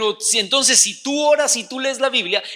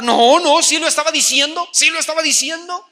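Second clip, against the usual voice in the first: louder, higher indignant insisting; angry insistence; 6 semitones higher, 6 dB louder than usual. Retold or imitated. Customer insisting to the shopkeeper, angry. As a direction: insist he did call her a thief. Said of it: "estaba pero enojadísima".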